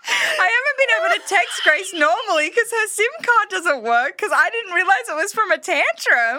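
realizing why her friend texts from email, laughing shriek; laughing realization. Real, not acted. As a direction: crack up as it dawns on you.

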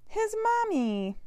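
high, loud, drawn-out exclamation; stretched, excited storybook climax; joyful reveal. A narrator's reading. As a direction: sing it out with delight. Bear joyfully reaches his mother.

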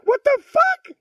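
high, loud mock-shocked yell; shocked outburst. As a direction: shout it in comic disbelief. Shocked retold reaction to the doctor.

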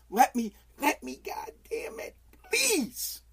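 mock despair for sleep; whimpered plea.

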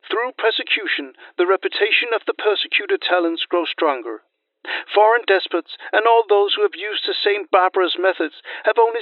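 loud, ringing oratory; roman orator. Acted. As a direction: declaim it to the Senate. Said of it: "Tacitus praised Cremutius".

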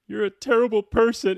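high, loud re-enacted yell; imitated angry yell. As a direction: hurl the accusation like the original. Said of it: "she yells at the girl".

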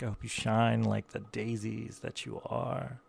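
ordinary talk

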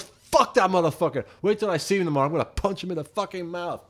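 high-pitched retold angry rant; going off. Singer going off to Wookie on the bus, fuming. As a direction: spit threats in a re-enacted temper. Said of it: "I start going off".

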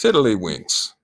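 hammy fake Shakespearean word; mock Elizabethan flourish; mock olde english. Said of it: "if my Shakespeare talk was bad".

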